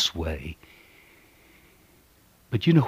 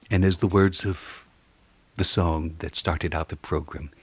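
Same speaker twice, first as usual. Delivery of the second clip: ordinary talk, nothing marked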